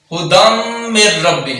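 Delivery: ھدم من رب with the tanween merged into the م. Shown as a demonstration. Tajweed teacher demonstrating the merge slowly. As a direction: glide the ن straight into the م. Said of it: "دال کو میم میں مدغم کر کے".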